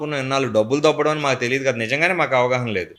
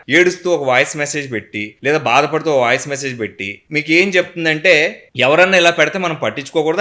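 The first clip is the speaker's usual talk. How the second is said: usual speaking voice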